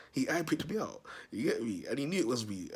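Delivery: ordinary talk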